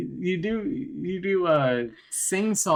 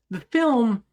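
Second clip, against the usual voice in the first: higher, swooping YouTuber voice; Ryan Hollinger impression; 5 semitones higher than usual. Doing a YouTuber's movie summary, swooping up and down. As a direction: go up, then come back down. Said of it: "when you do an impression of him".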